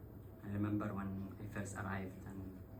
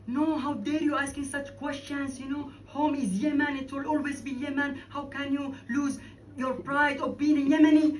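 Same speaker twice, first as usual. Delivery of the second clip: outraged, much higher and louder protest; rising indignant cry in defence of home; voice going up; 10 dB louder than usual. Retold or imitated. Defending Yemen to a dear friend, high indignant outburst. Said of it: "my voice started going up".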